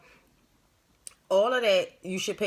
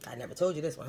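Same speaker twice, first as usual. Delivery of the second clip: usual speaking voice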